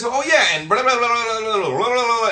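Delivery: high, loud blah-blah mimicry; parroting show-off. Man repeating lessons to impress a woman, loud mocking mimicry. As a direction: imitate a copycat running his mouth. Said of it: "they'll start repeating everything I just said".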